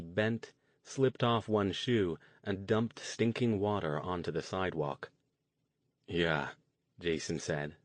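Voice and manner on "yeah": a touch of dejection, understated; low, deflated yeah; dejected. A narrator's reading. Jason admitting defeat to his friends, mildly dejected.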